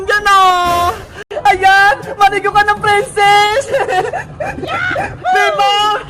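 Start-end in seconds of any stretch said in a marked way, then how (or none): 3.8-5.3 shrill playful laughter, pitched way up; high shrieking laugh. Acted. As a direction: squeal with glee as you spray. Laughing while spraying water. (mataas na tili ng tawa)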